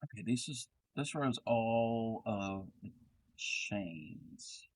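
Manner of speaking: ordinary talk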